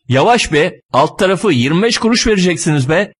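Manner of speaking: irritated scolding. Acted. Driver protests at a slammed door, irritated scolding.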